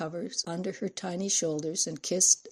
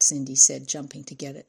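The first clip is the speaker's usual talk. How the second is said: plain reading voice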